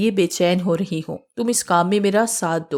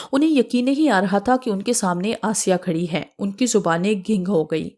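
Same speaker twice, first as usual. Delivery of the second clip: ordinary delivery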